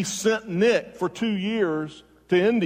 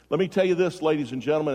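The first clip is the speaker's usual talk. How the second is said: ordinary talk, nothing marked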